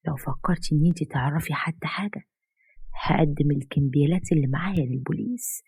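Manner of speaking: hushed, breathy threat; whispered warning to the mother-in-law; menacing whisper. Acted. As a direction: lean in and whisper the blackmail.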